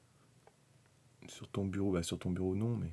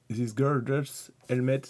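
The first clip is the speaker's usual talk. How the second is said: slow French-accented English read-out; drawn-out English in a French accent; self-mocked English accent; 3 semitones higher, much slower than usual. Shown as a demonstration. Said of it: "avec mon superbe accent anglais".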